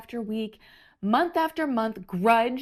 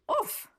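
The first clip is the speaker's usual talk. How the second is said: pained oof; 4 dB quieter than usual. Acted. Bumps knee.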